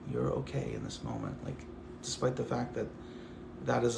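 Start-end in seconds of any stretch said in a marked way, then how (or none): 0.1-1.4 tender reassurance. Retold or imitated.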